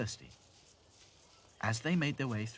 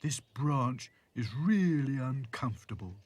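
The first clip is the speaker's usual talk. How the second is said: slow, higher-pitched grumble; moaning; 4 semitones higher, 4 dB louder, slower than usual. A narrator's reading. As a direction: moan slowly about the discomfort. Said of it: "he moaned".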